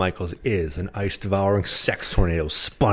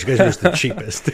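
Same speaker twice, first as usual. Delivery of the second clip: ordinary delivery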